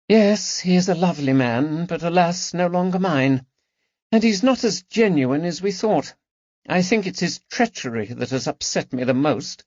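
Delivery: high, wounded aristocratic voice; grieving the loss of a lover to treachery; sad lady's lament. Acted. Lady Caroline lamenting to her friends, high and sad. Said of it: "she added sadly".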